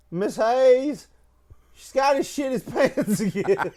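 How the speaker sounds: high, sing-song tattletale call; tattling kid. Retold or imitated. Kid tattling to teacher Miss Hayes, high sing-song call. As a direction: call out to the teacher like a gleeful little snitch. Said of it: "He goes".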